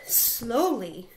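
ordinary delivery

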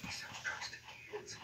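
slow, whispered question; hushed disbelief. Acted. Listener hears the ex sells drugs, hushed disbelief. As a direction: repeat it slowly under the breath, incredulous. Echoes shocking claim about the ex.

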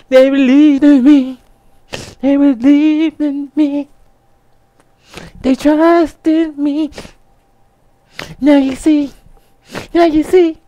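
high, hammed-up weepy speech; mock tearful. Creator holding plaque in video, overdramatic tearful. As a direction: choke out each phrase through fake happy tears. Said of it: "I had tears coming out my eyes".

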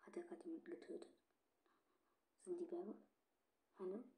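quick breathless whisper; tense whisper. Real, not acted. Gamer whispering while hiding in the round. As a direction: whisper fast so the werewolf won't hear.

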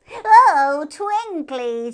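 very high, loud and delighted; a hare marvelling at a shiny thing. A narrator's reading. Hare character voice.

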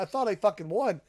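normal talking voice

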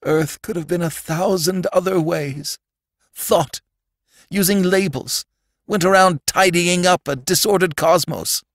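high-pitched sorrowful lament; tearful, trembling. A narrator's reading. Sandman standing over Robbie, starting to cry. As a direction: lament it on the edge of tears. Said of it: "beginning to tremble and cry".

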